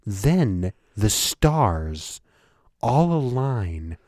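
slow, awed lecturing about stars; grand science-show narrator; Neil deGrasse Tyson. Retold or imitated. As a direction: savour every cosmic word. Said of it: "a really good Neil deGrasse Tyson impression".

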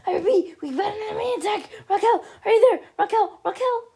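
high, loud frantic cry; panicked alarm. Acted. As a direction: raise the alarm under attack. Frantic alarm during enemy attack.

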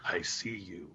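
low breathy creepy whisper. Pretend. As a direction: hush it like a stalker. Creepy stalker voice bit.

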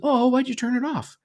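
imitating a let-down kid, very high; high little-boy complaint; protesting young son. Son protests as dad turns it off, high kid voice. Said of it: "And he's like".

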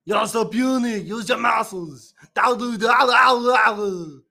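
loud, high straining Austrian strongman; taunt, then roar out mangled grunts; Arnold Schwarzenegger. Acted. Host repeats his impression for co-host, loud Austrian strongman roar. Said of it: "Sounds just like him".

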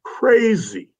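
high, amazed "Crazy."; a high, disbelieving "crazy"; incredulous exclaim. Real, not acted. Exclaiming about friends' reactions.

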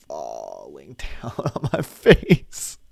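low, slow words broken by a laugh. Real, not acted. Laughing while telling girlfriend about his watery eyes.

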